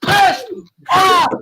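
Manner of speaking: venting fan shouting, near tears; furious rant. Real, not acted. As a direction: yell it out in real rage. Fan ranting about Arsenal defeat.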